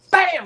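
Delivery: high, loud imitated punch sound; sudden impact "bam". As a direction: burst out with a high-pitched bam.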